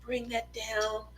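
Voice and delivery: soft murmuring painting teacher; gentle soothing art instructor; Bob Ross impression. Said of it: "he sounds like a performing, uh, performing arts, uh, instructor".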